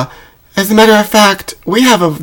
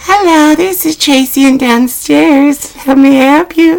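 much higher, brighter-pitched phone greeting; downstairs receptionist; 12 semitones higher than usual. Acted. Receptionist picking up the downstairs line, high-pitched phone voice. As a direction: answer the phone as a different, high-voiced Tracy.

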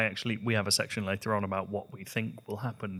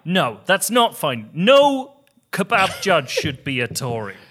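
mock outrage; 5 semitones higher, 11 dB louder than usual. Mock outrage at Tory judges.

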